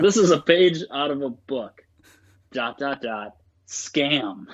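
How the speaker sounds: hammed-up one-star review voice; mock review readout. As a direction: read the complaint out with exaggerated emphasis.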